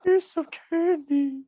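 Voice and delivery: thin, very high mock falsetto; creepy falsetto impression, hammed up; Paul Bearer. Friend playing a funeral-home man offering candy, eerie high falsetto. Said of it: "talks in a falsetto voice like that".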